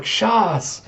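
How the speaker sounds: high, falling exclamation; called-out foreign word. Acted. Player on the sand calls a word at the learner, high exclamation. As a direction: call it out as an instruction.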